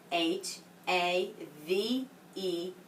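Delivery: H, A, V, E said one by one, slow and clear; slow spelling. Shown as a demonstration. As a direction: spell each letter out slowly for children. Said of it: "let's spell it again".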